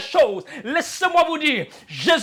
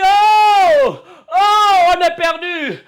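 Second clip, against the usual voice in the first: high, loud wail of despair; 3 semitones higher, 5 dB louder, much slower than usual. Retold or imitated. Believers facing hardship crying to God, despairing wail. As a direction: let out a cry of despair. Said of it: "On crie".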